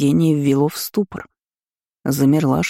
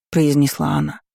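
ordinary delivery, nothing marked

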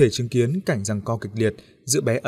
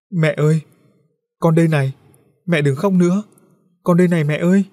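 put-on child's voice calling out higher and louder; pleading child; 6 semitones higher, 6 dB louder than usual. A narrator's reading. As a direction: beg mom to hear you. Ghost boy begging crying mother.

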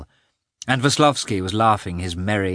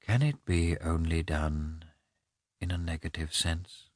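quiet musing; 3 semitones lower, 8 dB quieter than usual. A narrator's reading. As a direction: ask yourself quietly. Lievin muses to himself.

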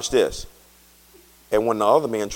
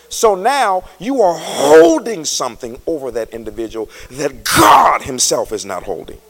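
loud, high, slow hollered preaching; preaching at full force; 14 semitones higher, 11 dB louder than usual. Real, not acted. Pastor to congregation on forgiveness, full-force preaching. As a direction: whoop it at the congregation.

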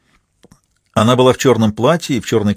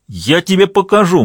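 threatening; 8 semitones higher than usual. Acted. Drunk man threatens and attacks.

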